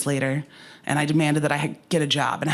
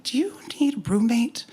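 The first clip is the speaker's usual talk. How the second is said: high, hopeful asking; retold sheepish question in a raised voice; sheepish request; 8 semitones higher than usual. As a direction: pitch it up like a tentative favor. Sheepishly asking mom to move in.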